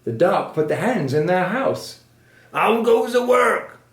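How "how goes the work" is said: high, loud, drawn-out farmer's call. A narrator's reading. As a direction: call out slowly as the lazy farmer. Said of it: "called the farmer".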